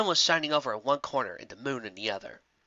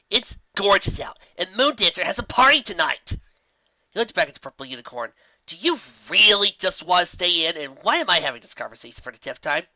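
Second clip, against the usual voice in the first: eager, exasperated little dragon; baby dragon voice; 5 semitones higher, 6 dB louder than usual. A narrator's reading. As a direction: push her to go out. Spike pleads, exasperated.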